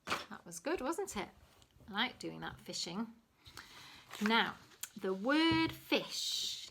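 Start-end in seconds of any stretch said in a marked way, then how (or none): 5.0-6.7 "the word fish" drawn out slowly, high start; slow stress on word fish. Shown as a demonstration. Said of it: "Let's have a think about the word fish".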